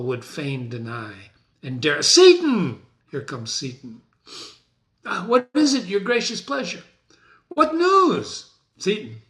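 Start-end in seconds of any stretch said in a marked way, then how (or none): 2.0-2.8 high shout of the name; loud call. Acted. Macbeth shouts impatiently for his servant Seaton. 5.1-8.5 servant voice. Acted. Servant Seaton answers.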